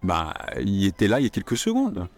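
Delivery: offhand shrug of a sentence; relaxed everyday French; casual bah. Shown as a demonstration. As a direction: start with a loose shrugging bah. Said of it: "don't hesitate to use words like bah".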